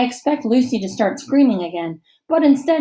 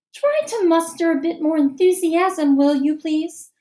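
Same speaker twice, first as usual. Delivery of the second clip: higher teacher's chiding; 7 semitones higher than usual. A narrator's reading. Teacher chides a sulking boy, higher. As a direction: gently scold the sulker. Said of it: "says Missus Beam".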